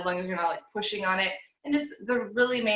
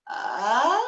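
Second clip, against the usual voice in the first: skip into head voice; 4 semitones higher, 4 dB louder than usual. Shown as a demonstration. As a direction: slide jumping up into head voice. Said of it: "skipping from ah all the way up there".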